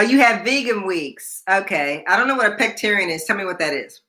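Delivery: usual speaking voice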